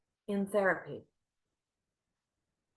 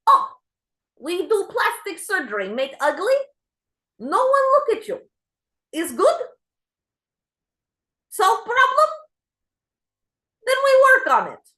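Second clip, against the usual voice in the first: put-on much higher and louder Ukrainian accent; 12 semitones higher, 6 dB louder than usual. Therapist cheerfully suggests plastic surgery, Ukrainian accent. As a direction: pitch surgery as a sunny solution, Ukrainian accent. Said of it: "she has an accent like this".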